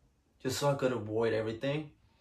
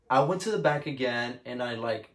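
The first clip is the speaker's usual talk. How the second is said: ordinary delivery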